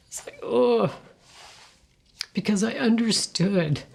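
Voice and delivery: normal talking voice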